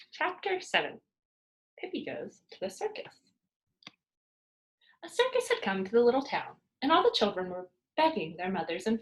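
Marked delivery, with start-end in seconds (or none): none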